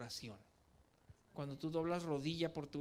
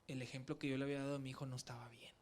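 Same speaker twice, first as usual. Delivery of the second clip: whispered, a bit fast, full of regret; sad whisper; 3 semitones lower, 5 dB quieter, faster than usual. Real, not acted. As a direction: confess it under your breath. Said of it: "me dolió tanto". (susurro triste y rápido)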